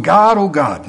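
retold exasperated prayer. Preacher recalling his reply to God, pleading. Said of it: "And I'm going".

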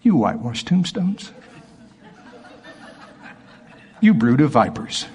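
deliberately toothless, held-back insult; mock mild rebuke. Preacher mimicking a too-gentle Jesus, mock mild. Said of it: "I can't see him saying it like this".

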